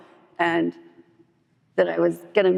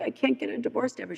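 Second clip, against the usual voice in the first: high, soft mock whine; retold sobbing; 6 semitones higher, 7 dB quieter than usual. Sponsee protesting to sponsor about divorce, mock tearful. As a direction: plead it small and high, imitating tears. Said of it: "I was sobbing".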